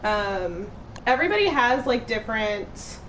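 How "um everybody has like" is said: ordinary talk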